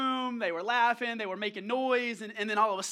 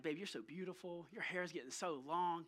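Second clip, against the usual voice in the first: very soft, low fatherly tenderness; retold tender compliment; 6 semitones lower, 12 dB quieter than usual. Dad doing his daughter's hair, soft tender praise. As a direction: speak softly and lower to a little girl.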